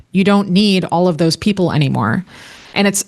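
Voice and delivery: ordinary talk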